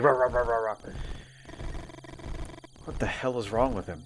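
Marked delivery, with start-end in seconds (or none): 0.0-0.8 mock dog barks, rapid and repeated; imitated barking. Streamer mimics the dog barking through her decision, mock barking. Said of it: "they're just like".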